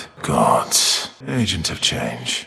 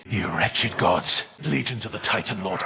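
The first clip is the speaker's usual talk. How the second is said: ordinary delivery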